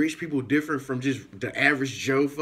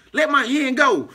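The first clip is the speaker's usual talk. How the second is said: louder and higher, half-laughing insistence; mock loud demand; 9 semitones higher, 6 dB louder than usual. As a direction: yell at the stranger to let go. Demanding hand be released.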